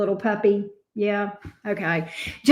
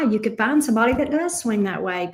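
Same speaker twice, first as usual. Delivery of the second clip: ordinary talk, nothing marked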